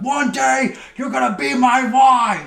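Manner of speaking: high, loud shouted threat across the street; yelling Biff. Retold or imitated. Biff yelling at Lorraine from across the street, loud and high. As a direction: bellow it like a thug claiming a girl. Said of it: "he yells from the street".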